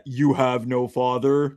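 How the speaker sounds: low, slow movie villain; Vader voice. Acted. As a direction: intone it like Vader. Quoting Vader line.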